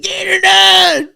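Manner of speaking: high, loud, stretched fan holler; slow, screechy crowd yell; crowd shouting. Retold or imitated. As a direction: imitate a rowdy fan yelling the catchphrase. Imitates crowd yelling at his show.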